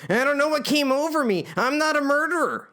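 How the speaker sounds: high, desperate plea; pleading. Acted. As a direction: beg them to believe you, voice up high. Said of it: "he pleads".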